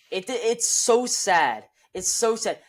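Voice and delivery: high, drawn-out lament; exasperated lament. Real, not acted. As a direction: lament it with slow, high emphasis. Lamenting a wasted team.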